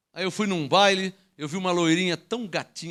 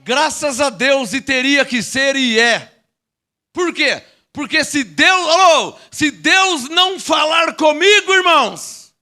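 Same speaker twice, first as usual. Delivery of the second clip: slow, loud shouted sermon; stretched, booming preacher cry; full-force preaching; 5 semitones higher, 9 dB louder, much slower than usual. Acted. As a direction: drive each word to the back of the church.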